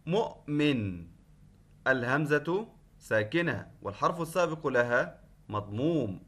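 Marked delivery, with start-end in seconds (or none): 0.1-1.1 مؤمن drawn out slowly, each sound clear; slow spelled-out word. Shown as a demonstration. Teacher sounding out a word for pupils, slow and clear. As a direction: say مؤمن slowly so the hamza is heard. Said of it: "كلمة".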